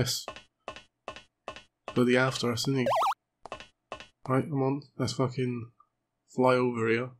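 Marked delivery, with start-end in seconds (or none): none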